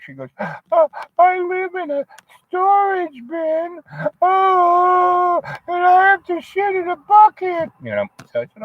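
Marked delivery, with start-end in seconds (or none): 0.4-7.7 high falsetto wail, slow; hammed-up tearful girlfriend confessing her home; mock crying. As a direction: whimper it out much higher and slower. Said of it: "And she starts crying".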